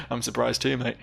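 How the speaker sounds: ordinary talk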